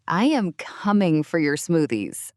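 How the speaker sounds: ventriloquist doll voice; words squeezed through clenched teeth; through gritted teeth. Pretend. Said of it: "You sound like a ventriloquist doll".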